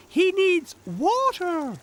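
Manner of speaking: very high, slow, loud cartoon voice; squeaky character explaining; Tech. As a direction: spell it out in a piping little voice. Narrator voices Tech answering.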